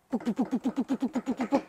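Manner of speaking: chest-thump sound. Acted. Chest-beating sound effect.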